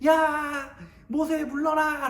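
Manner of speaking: high mob shout; crowd shouting. Acted. Rebelling crowd shouting at Moses, loud and high. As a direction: yell for Moses to step down. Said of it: "라고 이렇게 막 얘기하기 시작하는 거예요".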